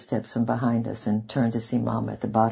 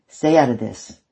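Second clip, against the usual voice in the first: sharp, louder snap; snapped; 8 dB louder than usual. Acted. Father snapping at his son to stay out, angry. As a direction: cut him off hard. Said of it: "Isaac snapped at him".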